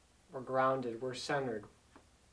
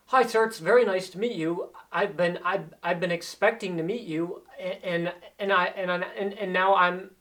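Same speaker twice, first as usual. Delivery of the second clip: higher, louder nervous greeting that trips over itself; new hire stammering a nervous hello to someone important; 4 semitones higher, 6 dB louder than usual. Shown as a demonstration. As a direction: stammer it out flustered, repeating words. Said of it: "I'm stuttering".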